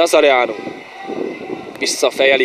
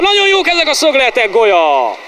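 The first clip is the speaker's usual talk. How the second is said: excited commentary; 9 semitones higher than usual. Real, not acted. Corner, header chance.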